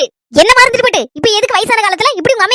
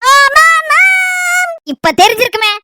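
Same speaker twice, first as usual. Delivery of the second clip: menacing boast; slower than usual. Acted. Villain boasts, slow dramatic.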